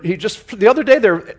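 ordinary talk, nothing marked

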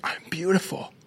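crying. Retold or imitated. Grad seeing herself in the gown, quietly crying. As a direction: say it through tears, amazed. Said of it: "starts crying".

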